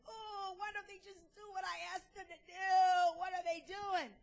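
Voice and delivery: mock exasperated whine; impatient whining. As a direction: moan it like human nature losing patience. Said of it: "against our human nature, you know".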